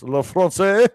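French accent with a rising end on "France"; "La France" in a French accent, lifting the end; hesitant French accent try. Shown as a demonstration. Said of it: "My French accent".

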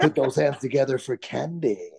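hammed-up strip club announcer; fake DJ voice. Shown as a demonstration. Ex-DJ showing his old club introduction, fake DJ voice. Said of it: "it's the fake D J voice".

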